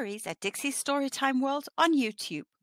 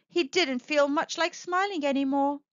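usual speaking voice

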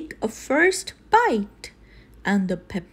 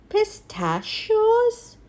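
surprised; 7 semitones higher, slower than usual. Acted. Gang puzzled by new topping.

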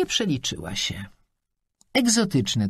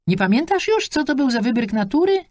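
put-on high, louder old lady's jab; old woman mocking; 12 semitones higher, 5 dB louder than usual. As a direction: needle her about the husband, voice pitched high. Old woman mocking friend's husband.

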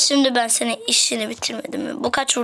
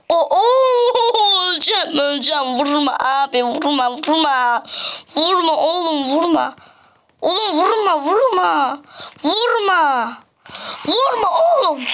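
hammed-up whimpering, pitched higher; mock crying plea; 3 semitones higher than usual. Player begging the attacking mob in mock tearful wails. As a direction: beg not to be hit, half-sobbing, half-laughing.

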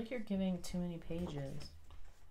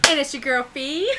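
high, loud hyped-up intro with a laugh; way too much energy, shouting the sign-off intro; over the top; 7 semitones higher, 12 dB louder than usual. Acted. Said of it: "It's too much".

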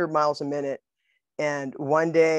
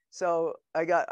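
normal talking voice

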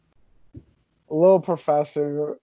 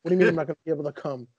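louder, higher disbelieving outburst; a startled player demanding an explanation; retold shock; 5 semitones higher, 4 dB louder, faster than usual. Retold outburst at being told he can't come.

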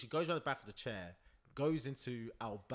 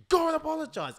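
very loud, high bellowed order; explosive parental demand; mock furious shout; 14 dB louder than usual. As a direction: imitate an enraged dad shouting. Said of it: "he's getting more and more mad".

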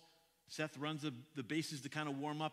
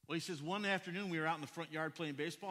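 normal talking voice

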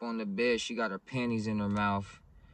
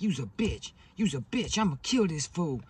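higher-pitched mock outburst; retold fury in a raised pitch; imitated angry woman; 6 semitones higher, 3 dB louder than usual. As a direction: act out her accusing threat, pitch climbing. Woman's furious outburst acted out.

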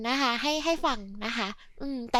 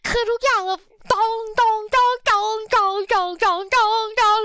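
imitating the beat; 5 semitones higher, 3 dB louder, slower than usual.

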